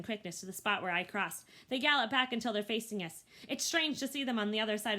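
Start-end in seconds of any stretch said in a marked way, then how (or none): none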